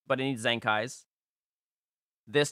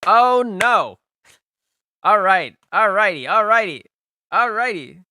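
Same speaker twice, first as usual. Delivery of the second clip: loud, high groan of dismay at a bad team; oh no, then resigned all righty; 4 semitones higher, 7 dB louder than usual. Real, not acted.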